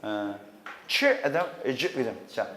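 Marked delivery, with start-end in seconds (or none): none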